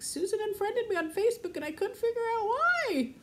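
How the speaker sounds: high-pitched mock wail; exaggerated upset complaint; retold wailing. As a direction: whine it high, re-enacting being upset. Re-enacts upset answer to husband.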